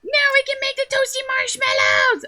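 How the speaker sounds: squeaky excited creature. Acted. Little creature thrilled about marshmallows, high squeal.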